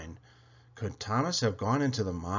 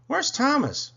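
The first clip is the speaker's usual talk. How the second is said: high, loud, concerned question; Stanley, asking; 13 semitones higher, 5 dB louder than usual. A narrator's reading. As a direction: ask it in a raised, calling voice. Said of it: "Stanley asked".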